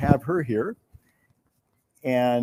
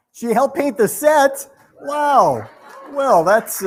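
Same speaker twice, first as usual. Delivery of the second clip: much higher, louder, amazed question; surprised; 11 semitones higher, 8 dB louder, much slower than usual. Real, not acted. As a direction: react with real amazement to the news. Learns the winner painted the set.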